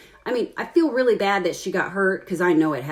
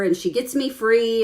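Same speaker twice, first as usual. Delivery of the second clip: ordinary talk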